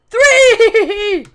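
loud, high count; triumphant shout. Real, not acted. Gamer counting bow hits, shouting the final one. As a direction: yell the last number.

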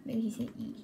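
whispered aside. Real, not acted. Gamer whispering a guess to viewers, whispered. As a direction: breathe it to yourself. Quiet aside while gaming.

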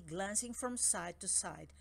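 ordinary delivery, nothing marked

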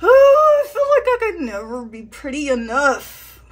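loud, wailing mock despair; overdone melodrama; dramatic lament.

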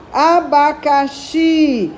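Abacaxi slowly, with the final xi leaned on; stress on xi. Shown as a demonstration. Teacher asking pupils which syllable of Abacaxi is strong. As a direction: draw out Abacaxi and land on xi. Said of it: "qual é a mais forte?".

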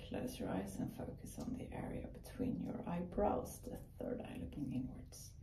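soft unvoiced meditation whisper. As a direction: breathe the words out softly. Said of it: "repeated voiced later".

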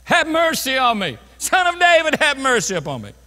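high, loud, desperate plea for mercy; crying out loudly. Acted. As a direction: cry out to be heard over the crowd. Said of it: "he cried more loudly".

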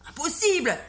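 high, loud exclamation; teacher crying out in disbelief; exclaiming. Acted. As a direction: shout your disbelief. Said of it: "s'exclama monsieur Normand".

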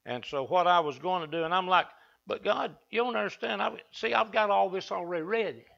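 usual speaking voice

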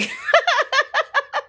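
high burst of laughter. Real, not acted. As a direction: crack up out loud. Loud laugh. (ऊँची ठहाकेदार हँसी)

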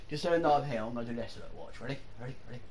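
ordinary talk

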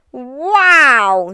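loud, high, drawn-out wow; thrilled wow. A narrator's reading. Norman the slug after his flight, thrilled. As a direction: burst out exhilarated.

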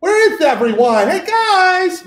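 high, laughing mock call; goofy casual God. Lecturer mocking the modern image of God, high goofy call. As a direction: call out like a clueless buddy looking for friends. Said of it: "have this image of God being like".

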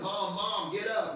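put-on high, loud kid calling a parent awake; child yelling. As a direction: yell like a scared kid at the bedside. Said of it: "yelling at you".

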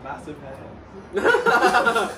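laughing. Acted. Improv character banter.

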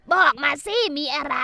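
impatient shouted demand; exasperated shout. Acted. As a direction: snap at her to spit it out. Scolding the crying daughter-in-law.

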